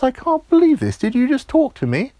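loud, very high disbelief; high-pitched, incredulous exclamation; astonished. Acted. Georgia finding the straws talk to her, loud astonishment.

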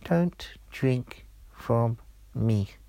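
tiny, slow talking-straw voice; small voice, word by word. Acted. Talking straw warning Georgia, in a tiny slow voice. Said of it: "the tiny voice said".

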